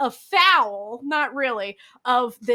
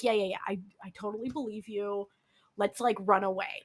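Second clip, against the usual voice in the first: soothing husband; 5 semitones lower, 9 dB quieter than usual. Retold or imitated.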